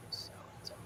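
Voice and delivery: sarcastic whisper under breath; whispered aside. Real, not acted. Sarcastic aside about dolphins.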